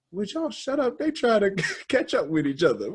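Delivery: high-pitched mock scolding, breaking into laughter; [name]. Retelling a reply to complainers, amused high-pitched scolding. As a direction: imitate someone telling complainers off, high and laughing. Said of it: "[name] going up like".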